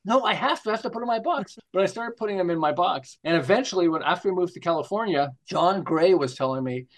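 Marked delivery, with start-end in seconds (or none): 0.1-1.6 whiny protest. Retold or imitated. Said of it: "Do not tear it out".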